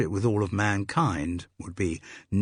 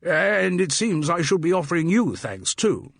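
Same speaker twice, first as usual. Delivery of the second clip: pitched-up, hearty public school English; hearty public school accent; 10 semitones higher, 4 dB louder than usual. A narrator's reading. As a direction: boom out thanks like a jovial public schoolboy. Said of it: "he said in a hearty public school accent".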